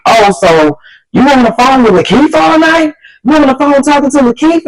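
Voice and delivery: high, incredulous questioning; imitated accusing boyfriend. Boyfriend asks her about the late phone call, accusing.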